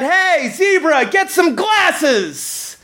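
drawn-out, high-pitched mock yell; imitated heckle. Somebody in the football crowd heckles the referee, mock yelling. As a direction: call it out like a heckler, stretching the words. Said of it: "somebody yells out".

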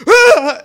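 short, high, loud yell; mock night-terror scream. Father waking with night terrors at three a.m., imitated scream. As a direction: imitate a sudden scream from sleep. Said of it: "I would hear".

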